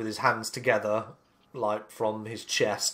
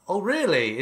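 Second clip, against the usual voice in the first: pitched-up, mildly astonished response; sudden belief; 7 semitones higher than usual. Acted. Keeper suddenly believing Nook, surprised. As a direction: let surprise lift the voice. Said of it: "his demeanor automatically changes".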